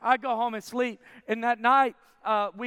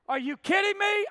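high-pitched, thrilled disbelief; excited disbelief; 10 semitones higher than usual. Retold or imitated. Patient offered free eye surgery, excited disbelief. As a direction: blurt it out, much higher.

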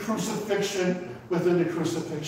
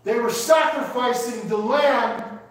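fervent preaching; 5 semitones higher than usual. Real, not acted. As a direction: hammer each phrase home to the congregation. Preacher builds at full force.

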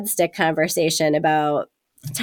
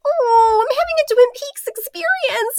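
very high, squeaky mock valley girl; squeaky little voice. Imitating Alicia Silverstone's squeaky little voice as she complains. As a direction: squeak it out cutely. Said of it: "And she's like".

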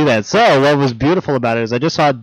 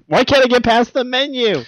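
high whiny complaint; 11 semitones higher than usual. Retold or imitated. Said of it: "He's like".

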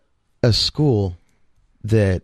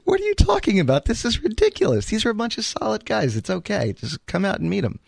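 much higher, exasperated pleading; incredulous protest; 10 semitones higher than usual. Retold or imitated. To his wife on the phone, urging her to meet the guys, incredulous and pleading. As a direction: talk her round, baffled she won't come.

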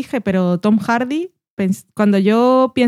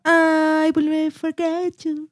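dramatic TV quote; 7 semitones higher, slower than usual. Retold or imitated. Quoting a TV line.

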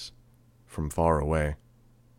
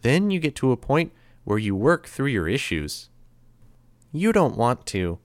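put-on higher, lilting, sweetly menacing boy; creepy cheerful threat; 6 semitones higher, 3 dB louder than usual. A narrator's reading. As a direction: toy with the captives in a higher voice. Grey Boy describing the punishment.

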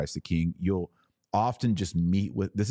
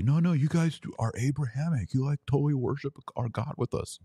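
high, mocking sing-song; mock-sweet parody of a well-meaning believer; mocking impression; 8 semitones higher than usual. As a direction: parody the naive ecumenist, pitched up.

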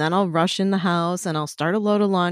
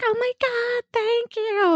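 very high imitation of a thrilled girl; gushing thanks; slower than usual. Counter girl thanking a customer for a tip, imitated high-pitched gush.

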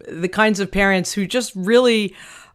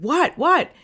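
startled dad; 9 semitones higher than usual. Retold or imitated. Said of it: "and he said".

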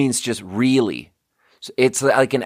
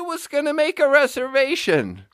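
caller's line jumps much higher, a mock scream. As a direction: imitate the old woman who shrieks on the phone. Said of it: "the person goes like this".

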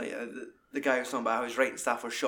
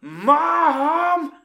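put-on loud whiny teenage shout, much higher; stroppy teen yell; 10 dB louder than usual. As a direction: snap back at mum like a sulky teen. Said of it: "like a stroppy teenager".

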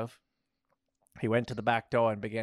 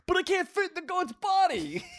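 very high squeal; hammed-up high-pitched protest; 3 dB louder than usual. A narrator's reading. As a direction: squeal the objection. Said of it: "he protested".

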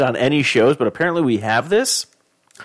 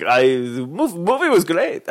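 mock Cuban; 6 semitones higher, slower than usual. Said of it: "I don't know why they sound like that".

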